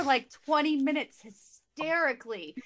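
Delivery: ordinary talk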